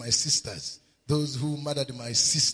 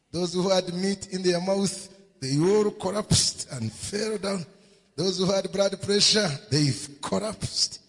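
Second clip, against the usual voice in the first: raised, forceful preacher's voice; impassioned preaching; 4 semitones higher, 6 dB louder than usual. Real, not acted. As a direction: drive it home to the congregation.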